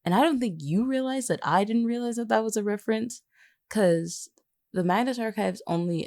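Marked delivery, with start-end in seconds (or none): none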